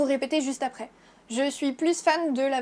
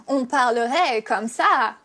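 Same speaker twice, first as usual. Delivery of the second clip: slow, swooping sing-song melody on every word, louder; English-style sing-song French; 6 dB louder, much slower than usual. Shown as a demonstration. Showing viewers French spoken with English intonation, slow sing-song. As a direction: stretch it out with exaggerated English intonation. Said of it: "si on parlait français comme on parle anglais".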